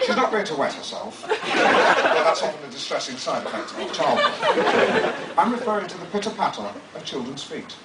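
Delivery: grandly narrated, savouring each phrase; dramatic book reading. Acted. Author reading his memoir to an audience, pompously. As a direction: read it like a pompous audiobook.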